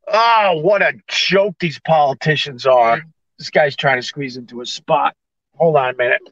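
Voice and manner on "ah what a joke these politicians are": ordinary talk, nothing marked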